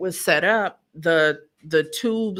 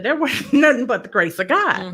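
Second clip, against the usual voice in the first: higher and louder, laughing with joy; laughing praise; 6 semitones higher, 5 dB louder than usual. Retold or imitated. To the puzzled doctor, a joyful laughing testimony. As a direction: beam as you give God the credit. Telling the doctor why bleeding stopped.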